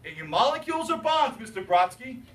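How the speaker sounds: loud, high, insistent student demand; mock a student overexcitedly pushing the teacher; emphatic. Imitating an overexcited student demanding an answer from Mister Brodsky, loud and high. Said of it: "so emphatic, you gotta calm down".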